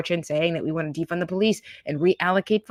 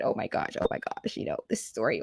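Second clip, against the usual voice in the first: mock teary whisper; 7 semitones lower, 8 dB quieter than usual. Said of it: "starts getting teary-eyed".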